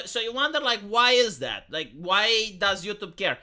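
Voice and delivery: ordinary delivery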